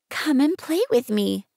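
little prince. Acted. Narrator voicing little prince.

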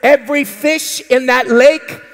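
normal talking voice

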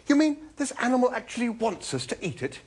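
appalled exclamation. A narrator's reading. Arthur learning the animal wants eating, appalled. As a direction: exclaim it high in disbelief. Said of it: "exclaimed Arthur".

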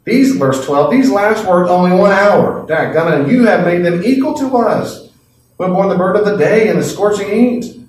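high, loud indignant protest; grumbling workers. Acted. Early workers protesting to the owner, loud indignant grumble. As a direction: complain like you've been cheated. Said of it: "they grumbled at the household".

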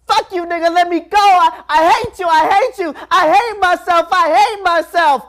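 high, loud raging scream; a raging girl screams high and loud at the man holding her legs; screaming in rage. Retold or imitated. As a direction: let the child's fury and self-hate pour out. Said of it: "she's screaming".

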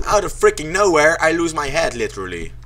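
normal talking voice

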